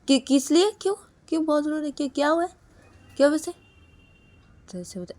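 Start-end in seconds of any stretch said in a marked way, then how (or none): 0.1-3.5 higher, louder worried asking; alarmed questions. Acted. Brother-in-law asking why she is crying, alarmed and loud. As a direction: demand to know what happened.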